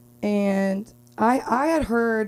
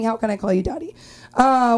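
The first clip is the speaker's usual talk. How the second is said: ordinary talk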